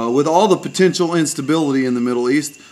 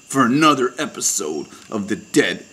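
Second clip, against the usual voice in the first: whispered sign-off; 5 semitones lower, 5 dB quieter than usual. Acted.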